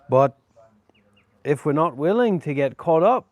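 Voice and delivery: earnest speech in a put-on Aussie accent; hammed-up Australian accent, phrase by phrase; mock Australian. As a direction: play the true blue Aussie. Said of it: "Maybe just talking like this".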